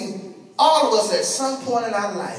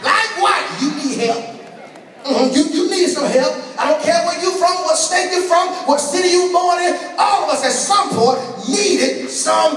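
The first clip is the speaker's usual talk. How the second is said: full-force preaching; 4 semitones higher than usual. Acted. Preacher driving the point home to the church in a raised preaching voice. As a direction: preach it, slowing down on help you.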